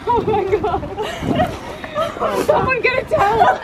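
high, breathless laughter; laughing from the cold shock. Real, not acted. Just got out of icy lake.

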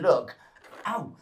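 usual speaking voice

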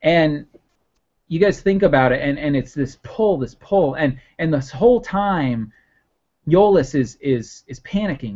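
normal talking voice

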